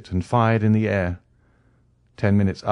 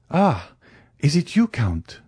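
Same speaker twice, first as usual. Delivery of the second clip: higher-pitched, understated gladness; almost joyful; 7 semitones higher than usual. A narrator's reading. Young man greets the Count on the island, almost joyful. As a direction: greet with a touch of joyful recognition. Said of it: "in an almost joyful accent".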